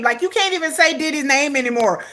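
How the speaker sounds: normal talking voice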